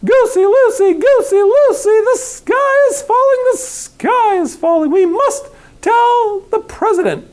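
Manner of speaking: high, loud, slow panicked yell; yelling hen. A narrator's reading. Chicken yelling to the goose, high and frantic. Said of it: "and yelled".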